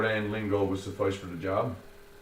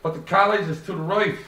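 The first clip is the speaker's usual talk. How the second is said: higher, louder confused Joey; 10 semitones higher, 7 dB louder than usual. A narrator's reading. Joey confused about the directions, higher voice. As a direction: protest in confusion. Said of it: "screwing his eyebrows down in confusion".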